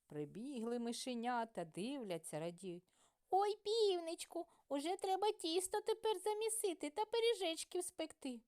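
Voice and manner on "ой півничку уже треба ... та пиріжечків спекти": very high squeaky pleading mice; mouse voices. A narrator's reading. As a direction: coax the rooster in a tiny high voice.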